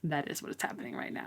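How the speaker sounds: fast unvoiced whisper; hushed confirmation. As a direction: whisper it like a secret.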